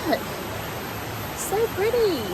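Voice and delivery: high, thrilled gasp at a view; delighted and awed; excited. Real, not acted. Sees a striking view.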